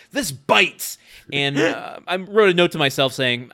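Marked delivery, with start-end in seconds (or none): 0.1-1.0 higher mock-sulky outburst; stomping-off huff. Son stomping off upstairs, mock angry protest. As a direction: toss it out in a teenage huff. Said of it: "starts to stomp off upstairs saying".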